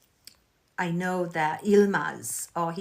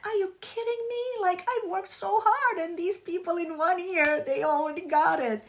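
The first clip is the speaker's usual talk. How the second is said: much higher, put-on voice of outraged disbelief; mock disbelief; 12 semitones higher, 4 dB louder than usual. The fan's reaction, retold to viewers, high mock outrage. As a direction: act out the fan's indignation, pitched way up. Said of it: "I'm like".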